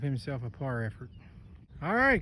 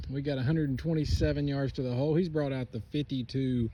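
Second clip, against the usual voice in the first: usual speaking voice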